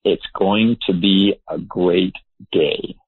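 slow, deliberate affirmation; measured morning pledge; positive declaration. Shown as a demonstration. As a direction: state it slowly, word by word. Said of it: "I will say".

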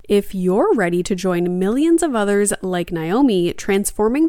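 loud, upbeat sponsor read. Acted. Host reading sponsor spots to listeners, upbeat ad read. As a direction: sell it bright and punchy.

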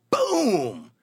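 shouted punch sound; loud boom. Retold or imitated. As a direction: slam out the impact word. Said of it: "I go".

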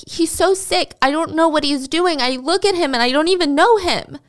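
high-pitched tearful upset, lightly acted; understated weepy complaint; mock crying. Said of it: "she starts crying and she's like".

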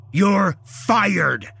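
high, loud shouted rant; furious firing. A narrator's reading. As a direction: roar threats at him. Shouting boss fires the employee.

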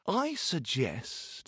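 slow, soft musing; slow thinking. Acted. Tailor stalling while he thinks, slow and soft.

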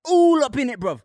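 put-on high-pitched British lad accent; exaggerated British "bro" talk. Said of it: "It's not okay to do accents anymore".

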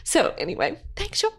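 crying through thanks. Real, not acted. Thanking listeners after losing her dog, tearful. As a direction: struggle through the last thanks. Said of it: "I cried through that part".

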